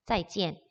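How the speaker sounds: 짜이지엔 with "jien", the A sounded as "e"; Mandarin pronunciation demo. Shown as a demonstration. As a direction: model 짜이지엔 low and falling at the end. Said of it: "이때 A는 에 발음이".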